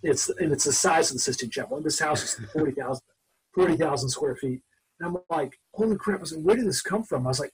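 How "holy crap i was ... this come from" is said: higher-pitched awestruck disbelief, retold; retold amazement. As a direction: relive being floored by the mansion. Stunned at friend's huge house.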